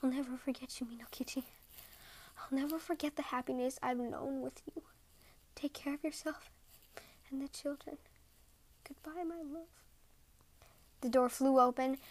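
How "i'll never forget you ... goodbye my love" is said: tender whisper. A narrator's reading. Snow woman whispers final farewell.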